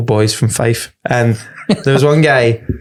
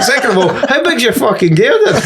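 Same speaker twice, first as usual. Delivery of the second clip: laughing retort; 10 semitones higher than usual. Retold or imitated. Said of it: "I went".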